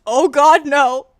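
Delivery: hammed-up horrified shout; mock horrified cry.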